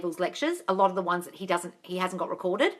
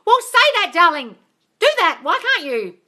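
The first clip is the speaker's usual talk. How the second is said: emphatic; 7 semitones higher, 9 dB louder than usual. Real, not acted. Urging a viewer to affirm.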